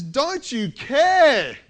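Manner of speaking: high, loud, slow accusation; accusing demand. Retold or imitated. Martha confronting Jesus from the kitchen, loud slow accusing. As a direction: confront him, drawn out and loud. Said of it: "She says".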